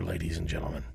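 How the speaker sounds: hushed dramatic aside; whisper. Pretend. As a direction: whisper it to the audience.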